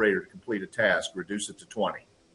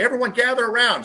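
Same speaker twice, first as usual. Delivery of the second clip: higher, louder call; calling the family; 10 semitones higher, 4 dB louder than usual. Retold or imitated. Father calling wife and kids together, raised voice. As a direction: summon the family into the room. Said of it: "show up and say".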